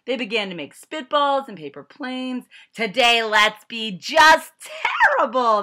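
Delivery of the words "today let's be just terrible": put-on loud, slow, high mischievous kids; naughty kids. A narrator's reading. Kids plotting mischief in a loud slow voice. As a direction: drawl it out like plotting brats. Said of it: "they said".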